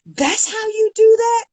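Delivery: high, slow, admiring disbelief; amazed question. Retold or imitated. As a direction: marvel at what she just saw. Colleague amazed by her calm.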